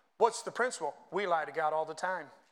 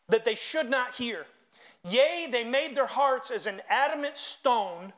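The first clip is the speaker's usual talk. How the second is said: raised, ringing scripture reading; preached scripture reading; 4 semitones higher, 5 dB louder than usual. Acted. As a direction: read scripture out forcefully to a congregation. Reads scripture aloud to congregation.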